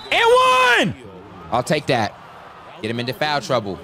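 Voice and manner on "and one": very high, loud yell; excited shout. Real, not acted. Gamer finishing a drive to the basket, excited shout. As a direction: scream the call as the shot drops. Calling out a scored foul.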